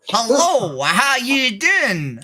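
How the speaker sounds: hammed-up Texas accent, lightly done; a Texan playing it up, drawling the vowels. Streamer performs a Texas accent for chat, hammed-up. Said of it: "You want me to do a Texas accent?".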